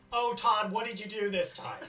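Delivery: mother yelling. Acted. Mother scolding Todd during his arrest, mock yell. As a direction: call it out like a fed-up mother. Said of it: "with Todd's mother yelling".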